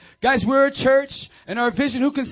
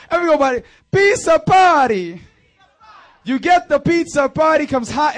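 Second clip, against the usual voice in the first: hype shout; 5 semitones higher, 7 dB louder than usual. Real, not acted. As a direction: pump up the room. Hyping the crowd.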